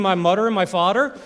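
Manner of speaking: "mother" and "father" with hard D: "mudder", "fodder"; Baltimore drawl. Retold or imitated. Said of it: "you called them something strange".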